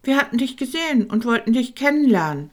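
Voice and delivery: usual speaking voice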